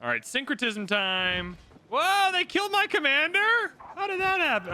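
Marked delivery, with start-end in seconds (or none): 1.9-4.7 a sudden much higher, louder "whoa"; shocked. Real, not acted. Streamer reacting to his commander being killed, high shocked cry. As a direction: shriek of disbelief at the loss.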